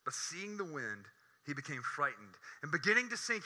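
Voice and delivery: ordinary talk, nothing marked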